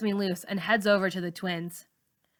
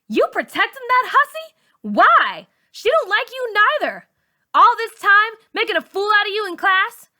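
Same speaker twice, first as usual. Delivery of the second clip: loud, much higher furious tirade; raging bully; 13 semitones higher, 10 dB louder than usual. A narrator's reading. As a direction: tear into her at full volume. Char raging at Malika.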